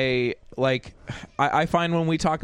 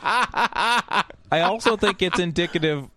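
high voice breaking up with laughter; laughing; 7 semitones higher than usual. Real, not acted. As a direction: push the point out through laughs. Still laughing, restarts the point.

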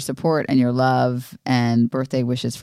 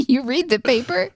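mock disbelief; 10 semitones higher than usual. Mock incredulous question.

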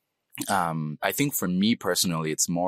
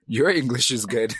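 higher, louder incredulous comeback; indignant retort; 6 semitones higher, 4 dB louder than usual. Acted. Immigrant retorting to praise of their English, mock-indignant. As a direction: throw it back, affronted but joking. Acted indignant reply to the compliment.

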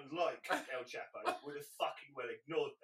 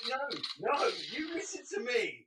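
raised, laughing mock order; mock stern; 8 semitones higher, 6 dB louder than usual. To his co-host, a laughing mock order to listen. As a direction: pretend to put him in his place, laughing.

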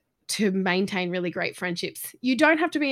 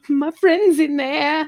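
excited; 6 semitones higher, 7 dB louder, slower than usual. Retold or imitated. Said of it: "I was so excited".

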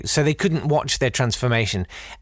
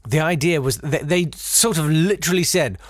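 normal talking voice

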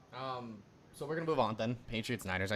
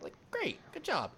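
sarcastic; slower than usual. Real, not acted. Mock praise for a brief lead.

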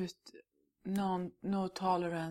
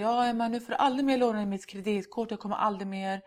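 louder, higher and fast, a mock rant; mock scolding; 3 semitones higher, 7 dB louder, faster than usual. Mother mock-scolding her daughter Emma over the credit card, fast and loud. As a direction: play the nagging mother in full rant, half in jest. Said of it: "Jag kommer liksom inte säga".

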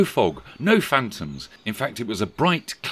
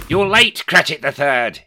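much higher, louder reproach; exclaiming; 8 semitones higher, 9 dB louder than usual. Acted. Scrooge scolding the late clerk, loud. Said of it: "he exclaimed".

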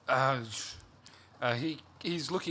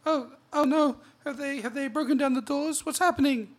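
panicked wife voice; 12 semitones higher, 6 dB louder than usual. Acted.

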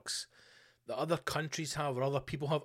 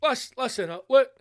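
loud, high boardroom rallying voice; fired-up executive; 6 semitones higher, 5 dB louder than usual. Acted. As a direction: address the lads with excited urgency. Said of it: "executives in a room going".

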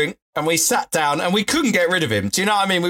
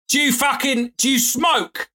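much higher mock-aggressive demand; imitating a rowdy bloke; 10 semitones higher than usual. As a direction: play the pushy local cornering them. Stranger aggressively demanding a cigarette.

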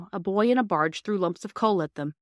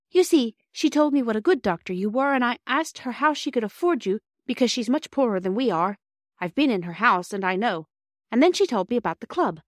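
put-on higher child's voice, explaining eagerly; earnest girl Bobby, pleading; 5 semitones higher than usual. Narrator voices Bobby pleading with doctor.

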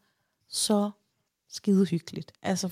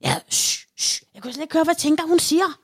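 hushing, then higher and louder; shushing grandma; 8 semitones higher, 4 dB louder than usual. Retold or imitated. Grandma shushing noisy grandchildren, irritated hush. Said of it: "så er det sådan".

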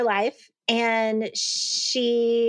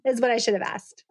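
usual speaking voice

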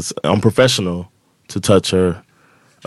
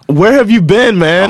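exasperated; 10 semitones higher, 4 dB louder, slower than usual. Retold or imitated. Exasperated retort in argument.

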